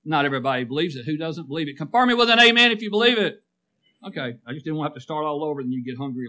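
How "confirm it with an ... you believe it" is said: loud, fast call for an amen. Acted. Preacher urging the church to answer, loud call. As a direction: rouse the congregation.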